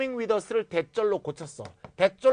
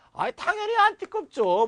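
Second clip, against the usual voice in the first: much higher and louder 당연히 안 띠껍죠; emphatic polite denial; 7 dB louder than usual. Pretend. Answering the window request, emphatically higher and louder. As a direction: insist brightly you don't mind at all. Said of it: "이렇게 하면 되겠죠".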